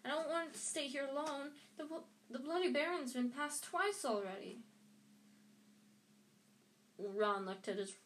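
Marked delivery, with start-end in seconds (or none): 0.0-4.6 higher-pitched, worried plea; anxious pleading. A narrator's reading. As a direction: plead not to be left, pitched up. Neville afraid of staying alone.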